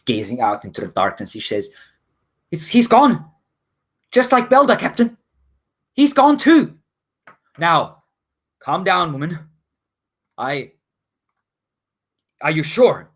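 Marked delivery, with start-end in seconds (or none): 2.5-6.8 high, loud frightened deckhand; scared, high-pitched female character voice; shaken, afraid. Acted. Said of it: "she looks pretty shaken and". 7.6-13.1 raised-pitch captain voice, questioning; steady a frightened crewmate; captain urging calm, a little unsettled. Acted. Captain tries to calm the deckhand.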